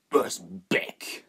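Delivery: Uzbek spat with contempt. Acted. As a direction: spit the word Uzbek back in scorn. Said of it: "the smuggler spat".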